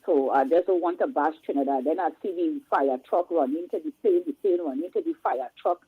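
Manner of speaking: ordinary talk, nothing marked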